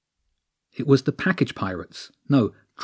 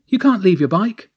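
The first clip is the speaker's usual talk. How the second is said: high, louder call to a mate; 10 semitones higher, 6 dB louder than usual. Acted. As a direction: call out high across the street. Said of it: "the thief called out".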